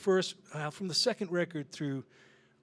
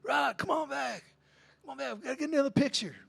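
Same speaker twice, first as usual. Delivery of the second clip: calling back; 11 semitones higher, faster than usual. Retold or imitated. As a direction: call him back across the bookstore. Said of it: "it's [name] going".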